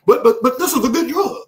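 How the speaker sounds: imitated pushy ad, tripping over the first word; mock sales pitch. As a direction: jump back up into the hard sell. Mock ad returns to selling.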